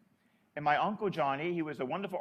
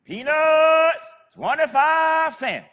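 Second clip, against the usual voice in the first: high, loud, drawn-out peddler's cry; loud, slow hawker's call down an empty sidewalk; street vendor's call; 10 semitones higher, 13 dB louder, much slower than usual. Retold or imitated. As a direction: call it out long and high like an old street seller. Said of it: "And she'd stop".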